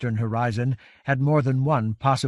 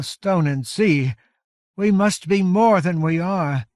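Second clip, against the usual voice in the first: raised, loud, weary giantess voice; exhausted giant leader; 6 semitones higher, 5 dB louder than usual. A narrator's reading. Iron Hand Coldspray pleading to Covenant, weary and solemn. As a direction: speak with spent, solemn strength. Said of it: "speak in a hoarse whisper".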